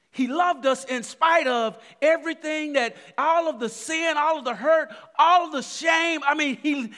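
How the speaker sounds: loud, high, building preacher's call; full-throated sermon climax; preaching at full force. Acted. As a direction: drive it home to the congregation.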